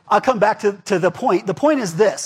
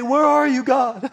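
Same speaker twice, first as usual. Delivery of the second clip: mock pleading, pitched high; plaintive cry to God; 6 semitones higher, slower than usual. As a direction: play the lost believer calling out. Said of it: "And then you say".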